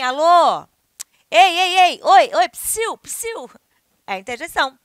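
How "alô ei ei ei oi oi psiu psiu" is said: loud high hey and psst; calling out. Shown as a demonstration. Teacher demos calling someone, loud hails. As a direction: get someone's attention. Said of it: "tá chamando alguém".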